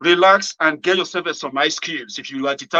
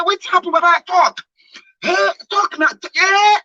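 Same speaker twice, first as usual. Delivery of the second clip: frantic pleading; 5 dB louder than usual. Retold or imitated. Pleading with the shocked friend.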